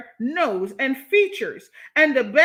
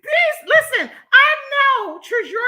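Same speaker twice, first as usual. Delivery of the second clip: high and loud, exclaiming; loud outrage; 12 semitones higher, 7 dB louder, slower than usual. Real, not acted. Streamer loudly calling out commenters. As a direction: raise the voice in outrage.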